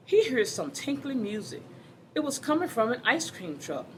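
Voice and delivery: lively, animated storybook reading; reading to children. Acted. Teacher reading a picture book to the class, animated. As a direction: read it out to a class of kids with bounce.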